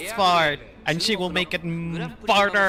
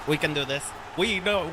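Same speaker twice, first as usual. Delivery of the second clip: normal talking voice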